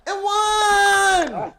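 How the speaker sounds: high, loud hyped yell; excited shout. Real, not acted. Commentator yelling the and-one to the stream, excited shout. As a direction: scream it like the play just won the game. Hyped call after the foul.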